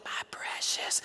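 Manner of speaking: hushed, voiceless imitation of a covetous creature; Gollum. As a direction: whisper it like an obsessed creature. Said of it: "Gollum going".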